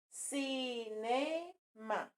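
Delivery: slow, drawn-out CI-NE-MA; syllable by syllable. Shown as a demonstration. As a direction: stretch "cinema" into three separate syllables. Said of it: "ela tem três sílabas". ("cinema" devagar, sílaba por sílaba)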